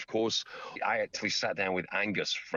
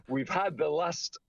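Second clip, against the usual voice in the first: ordinary talk, nothing marked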